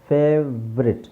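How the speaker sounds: ಫೇವ್ರೆಟ್ in two parts, fev-ret; correct pronunciation. Shown as a demonstration. Teacher modelling the short form, correct pronunciation. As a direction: drop the o in ಫೇವ್ರೆಟ್. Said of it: "ಅಂತ ಹೇಳಲ್ಲ".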